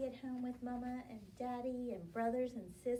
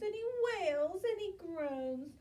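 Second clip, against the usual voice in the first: ordinary delivery, nothing marked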